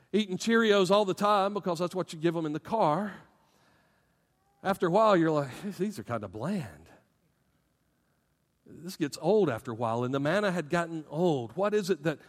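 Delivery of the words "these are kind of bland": low, quiet acted letdown; muted mock disappointment; unimpressed. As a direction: mimic an underwhelmed eater, sinking low and soft. Voices bored reaction to Cheerios.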